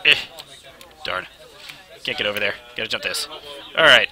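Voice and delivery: ordinary talk, nothing marked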